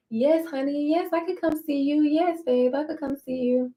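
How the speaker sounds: high, soft sugary agreement; sugary yes. Retold or imitated. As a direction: sweeten it up, playing along. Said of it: "I'm like".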